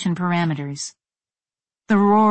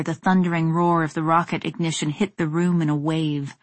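usual speaking voice

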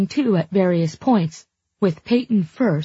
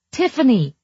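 high astonished call of her name; 7 semitones higher, 4 dB louder than usual. Acted. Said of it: "his voice high and astonished".

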